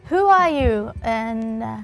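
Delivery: usual speaking voice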